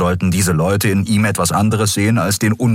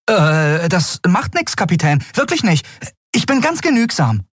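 put-on high, breathless eager boy; 12 semitones higher than usual. Miko to the captain promising anything, eager and high. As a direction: gush gratefully, high.

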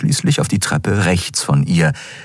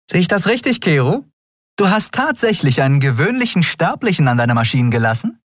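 higher voice for the captain Endriel, curious; Endriel, raised voice; 12 semitones higher than usual. Acted. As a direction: lift the pitch, eager question. Endriel teases Kero. (höhere Stimme, neugierig fragend)

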